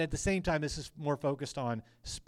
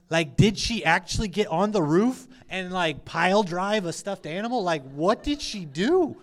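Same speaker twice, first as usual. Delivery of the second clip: baffled exclamation; 4 semitones higher, 5 dB louder than usual. Retold or imitated. Retold baffled reaction to daughter's noise.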